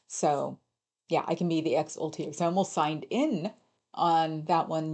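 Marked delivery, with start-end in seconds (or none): none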